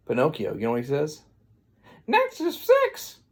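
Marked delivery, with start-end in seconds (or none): 2.1-3.2 very high, stretched-out squeal; squealed blurt. Retold or imitated. Pinocchio lying in class about numbers, high squeal.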